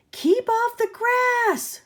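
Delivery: very high, loud, slow shout. Acted. Cactus shouting at the cowboy, high loud and slow. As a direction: shriek it at the passer-by. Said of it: "shouted Hank".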